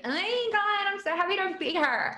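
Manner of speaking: high-pitched gushing party girl impression. Mocking a random girl at a party, gushy high-pitched voice.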